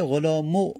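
غلامو with a clear "u" vowel on the end; damma ending. Shown as a demonstration. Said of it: "باید دمه بدیم". (با ضمه در آخر غلامو)

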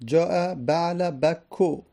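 slow, deliberate "جاء بعل بکو" with no nunation; آهسته و شمرده با ضمه; Arabic case ending demo. Shown as a demonstration. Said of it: "تنوین نمیدیم".

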